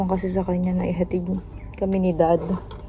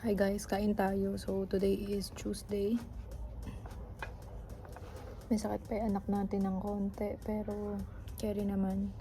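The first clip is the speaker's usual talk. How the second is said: hushed vlogging; 14 dB quieter than usual. Real, not acted. Quiet vlog at school.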